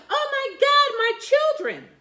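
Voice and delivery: high, loud panicked shriek; shrieking mother. Retold or imitated. A just-woken mother shrieks out for her children. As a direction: wail it like a frantic mother.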